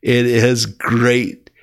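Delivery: very slow, savouring the word; slow emphasis. Real, not acted. Preacher marvelling at the cross, slow and emphatic. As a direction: drag it out slowly with wonder.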